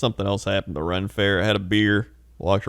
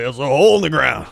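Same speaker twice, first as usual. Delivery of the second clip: put-on adamant old guy; 3 semitones higher, 3 dB louder, faster than usual. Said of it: "he's very adamant".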